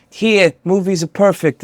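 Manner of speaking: drawn-out imitation Australian accent; Nicole Kidman. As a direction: put on a slow mock Australian accent. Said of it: "got an Australian accent".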